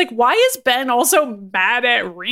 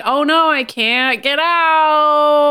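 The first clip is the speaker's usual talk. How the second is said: drawn-out, higher mock cry for help; fake distress; 4 semitones higher, 4 dB louder, much slower than usual. Reed pretends he can't get out of ropes, fake distress. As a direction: overplay being helplessly stuck.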